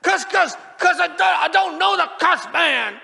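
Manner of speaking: very high, loud shouted denial; frantic mock-swearing outburst; furious denial. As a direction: scream pretend curses to deny it. Peter's angry cursing denial.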